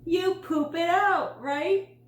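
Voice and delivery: high, loud, slow gleeful shout; enthusiastic yell. A narrator's reading. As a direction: belt it out to save the embarrassed boy. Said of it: "yelled out enthusiastically".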